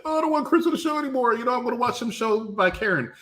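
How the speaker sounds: high, fast mocking whine; lightly voiced sneering fan; mocking AEW fans. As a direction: mimic a whiny fan. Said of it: "Here's what y'all gonna say".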